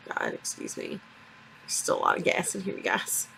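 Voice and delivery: breathy whispered aside. Real, not acted. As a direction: whisper it under your breath. Quiet aside after surgery.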